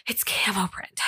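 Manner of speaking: breathless whisper; whispered, out of breath. Retold or imitated. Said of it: "she just sounds out of breath".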